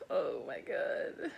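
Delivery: soft slow breathless whisper; awed whisper. Real, not acted. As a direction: breathe it out in awe. Awed by splashes on leaves.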